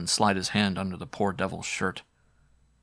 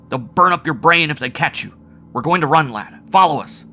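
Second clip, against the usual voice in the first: urgent shouting; 7 semitones higher, 10 dB louder than usual. A narrator's reading. Howard shouting orders to Wells.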